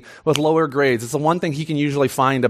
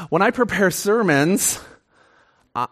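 ordinary delivery

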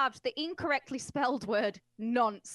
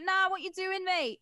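higher-pitched, mock-exasperated thug quote; incredulous, pitched-up interruption, hammed up; mock incredulous objection; 9 semitones higher, 4 dB louder than usual. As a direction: play a thug correcting his mate. Acting out thugs arguing over spelling.

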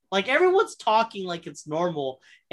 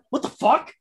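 surprised quote, pitched up and punchy; imitated surprise; 5 semitones higher than usual. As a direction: imitate a startled what-the-hell reaction. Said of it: "like he's so surprised".

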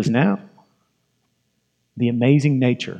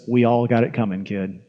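low, soft, understated gunfighter line; old gunfighter; 5 semitones lower, 5 dB quieter than usual. Retold or imitated. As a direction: say it low and quiet, dropping off on "kid". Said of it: "looks off in the distance and he says".

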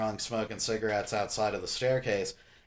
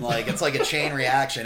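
ordinary talk

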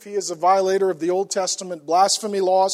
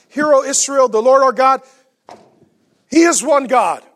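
proclaimed creed; 5 semitones higher, 7 dB louder than usual. Acted. Reciting the Shema daily prayer.

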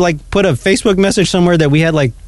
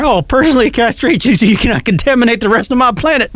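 drill sergeant; 7 semitones higher than usual. Retold or imitated. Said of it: "I love those lines from that movie".

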